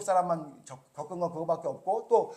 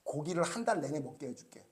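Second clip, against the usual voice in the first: reassuring God; 4 semitones lower, 7 dB quieter than usual. Retold or imitated. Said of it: "막 짜증을 내니까".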